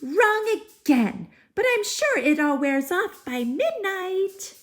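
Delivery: high, brisk fairy voice. Acted. Fairy excusing her wrong spell to Cinders, high voice. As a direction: brush it off like a cheerful fairy.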